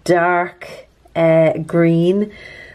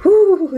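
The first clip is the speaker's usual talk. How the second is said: delighted high ooh; 11 semitones higher, 6 dB louder than usual. Real, not acted. Sipping wine, delighted.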